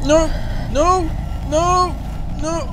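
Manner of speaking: high loud frightened yelps. Real, not acted. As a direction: yelp no in terror. Caught by Slender Man.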